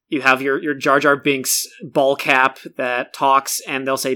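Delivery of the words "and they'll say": ordinary delivery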